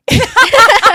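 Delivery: big high cackle; loud burst of laughter. Real, not acted. Guest laughing about being high at BravoCon, loud cackle. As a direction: explode into laughter at herself.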